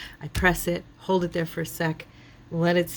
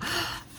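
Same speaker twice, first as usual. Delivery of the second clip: sharp high gasp; 13 semitones higher, 6 dB quieter than usual. Pretend. Mock discovery of hidden paper.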